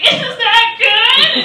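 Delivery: very high, loud, slow, crying small question; tearful squeak. Retold or imitated. Crying patient asks the nurse, tearful high squeak. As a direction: whimper it through tears, scared of the answer. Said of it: "I'm like".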